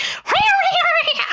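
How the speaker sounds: very high-pitched drawn-out squeal; awful laughing noise. Retold or imitated. Imitating girl classmate laughing at a joke, awful high squeal. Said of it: "it sounded a lot worse than before".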